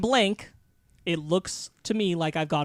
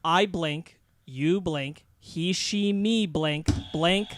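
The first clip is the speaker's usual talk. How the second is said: normal talking voice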